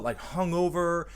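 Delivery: ordinary talk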